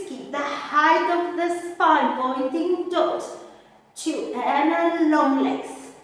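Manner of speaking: ordinary delivery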